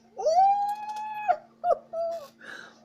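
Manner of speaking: high squeal of a laugh in disgust. Real, not acted. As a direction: shriek of cringing laughter. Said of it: "That feels so icky".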